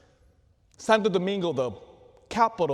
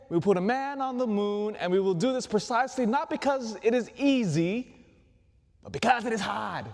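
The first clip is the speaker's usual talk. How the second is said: Kennedy accent impression; 5 semitones higher than usual. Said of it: "He had that weird accent".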